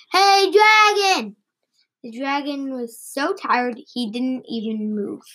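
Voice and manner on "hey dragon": high, drawn-out holler; loudest storybook shout. A narrator's reading. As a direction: shout it slowly as loud as you can. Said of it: "shouted as, as loud as she could".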